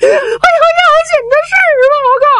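high, fast, tearful urgency; urgent crying plea. Acted. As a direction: blurt it out in a panic, near tears. Zhao Bi arrives urgent. (带着哭腔急促高声禀报)

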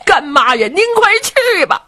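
put-on high wailing cry of a young man; crying plea. Du Cha to his godmother, high crying plea. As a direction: wail it out, begging her to hurry. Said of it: "见了老夫人就哭啊".

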